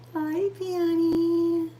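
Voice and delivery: high, slow cooing at a cat; pet talk. Real, not acted. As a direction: coo it up high to the kitty.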